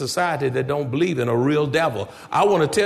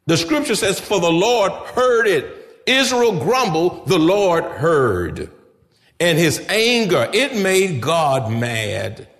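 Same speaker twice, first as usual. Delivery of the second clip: loud, high, rising preacher's voice; preaching at full force; 4 semitones higher, 4 dB louder than usual. Acted. Preacher telling the congregation of God's anger, loud and rising. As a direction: drive the story home to the congregation.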